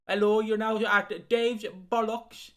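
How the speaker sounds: slow, loud mock Welsh announcement; Welsh train announcer. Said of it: "the fucking guy ... on the speakers".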